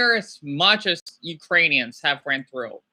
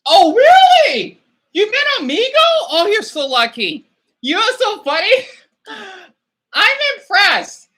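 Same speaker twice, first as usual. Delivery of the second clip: surprised; 13 semitones higher, 6 dB louder than usual. Real, not acted. Reading a viewer met someone on Amigo, surprised.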